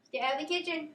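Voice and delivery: high calling scold; scolding the cat. Real, not acted. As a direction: order the cat out firmly.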